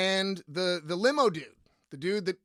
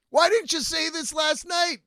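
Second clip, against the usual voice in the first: loud, high disbelieving demand; incredulous shout; 7 semitones higher, 4 dB louder than usual. Retold or imitated. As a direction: demand it, half laughing in shock.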